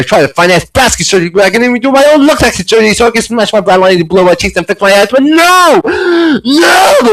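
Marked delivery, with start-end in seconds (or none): 5.1-7.1 high protesting shout; mock-tragic cry of denial; theatrical no; hammed-up outcry. Melodramatic outburst.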